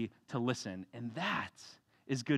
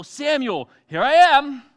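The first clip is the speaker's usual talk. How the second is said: much higher and louder, bright call and answer; spring up and answer eagerly; eager reply; 10 semitones higher, 14 dB louder, slower than usual. Acted. Samuel eagerly answering God's call at night. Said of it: "God calls Samuel".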